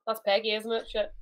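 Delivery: usual speaking voice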